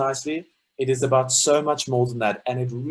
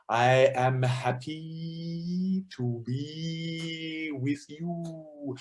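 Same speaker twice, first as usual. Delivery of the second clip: Desmond Tutu; 3 semitones higher, 5 dB quieter, much slower than usual. Retold or imitated. Choir teacher imitating an archbishop's rhythmic release, long and drawn out. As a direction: stretch each phrase out almost into a held note. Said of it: "Desmond Tutu has a very unique".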